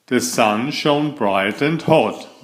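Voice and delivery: usual speaking voice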